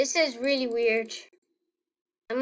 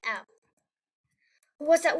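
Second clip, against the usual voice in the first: usual speaking voice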